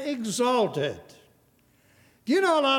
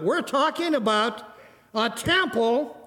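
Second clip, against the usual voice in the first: raised pitch, stretched-out proclamation; emphatic preaching; 6 semitones higher than usual. Real, not acted. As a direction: build to a ringing climax. Preaches at full force.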